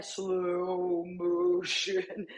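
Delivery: very slow, drawn-out slow-motion voice. Pretend. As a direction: stretch every sound like a slowed-down replay. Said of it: "I'm trying to do a slow motion voice".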